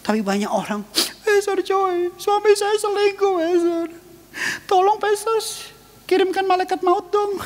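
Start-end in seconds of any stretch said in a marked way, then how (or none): 1.2-7.4 high, mock-pleading wife begging the pastor; whiny churchgoer. Preacher mocks a wife complaining about her cheating husband, high whiny plea. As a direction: ham up a wife who wants her husband struck dead.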